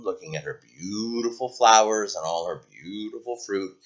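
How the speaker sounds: ordinary delivery, nothing marked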